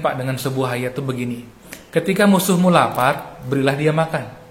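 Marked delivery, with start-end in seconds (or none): 1.9-4.4 preached scripture. Acted. Pastor recites Bible verse.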